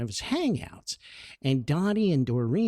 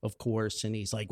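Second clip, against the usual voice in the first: normal talking voice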